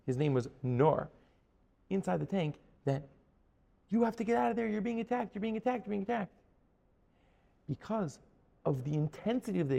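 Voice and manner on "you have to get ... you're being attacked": higher-pitched rapid-fire repeated warning; urgent warning. Retold or imitated. Army warning a soldier inside the tank, urgent. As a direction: urgently imitate a frantic radio warning.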